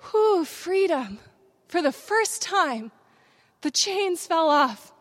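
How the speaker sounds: slow, high and loud exultation; exultant release. Retold or imitated. Speaker relives the chains of shame falling off, exultant. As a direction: draw out each phrase in triumphant relief. Reliving the moment of being freed.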